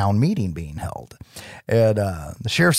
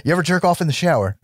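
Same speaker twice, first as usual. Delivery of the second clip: mock interrogator; 9 semitones higher than usual. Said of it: "mock polygraph question".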